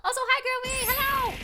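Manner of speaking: high, loud squealed hello; bubbly high-pitched welcome; excited greeting. Real, not acted. Greeting a viewer who arrives.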